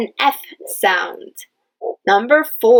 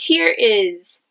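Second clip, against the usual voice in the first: normal talking voice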